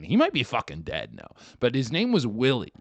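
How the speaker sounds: ordinary talk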